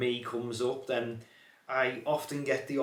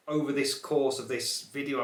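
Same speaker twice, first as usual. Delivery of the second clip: normal talking voice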